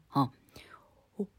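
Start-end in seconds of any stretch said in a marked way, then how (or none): 1.2-1.3 呜 very short, never lengthened; a quick little puff of 呜; 呜 clipped down to a consonant. Shown as a demonstration. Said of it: "基本上这个叫子音".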